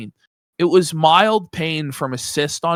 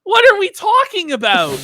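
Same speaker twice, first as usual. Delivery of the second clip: high, louder disbelief; exasperated outburst; 12 semitones higher, 5 dB louder than usual. Real, not acted. Host fed up with the man's logic, exasperated outburst.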